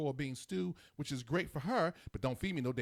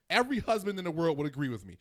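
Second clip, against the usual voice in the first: ordinary delivery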